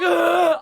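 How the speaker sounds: high, whiny imitation sob; mock crying whimper. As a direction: squeak out a mock little cry. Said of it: "when I cry, I'm like".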